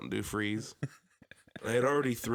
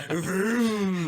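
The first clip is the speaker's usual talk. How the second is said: engine vroom; 10 semitones higher, 8 dB louder than usual. Retold or imitated. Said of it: "So I hit the gas".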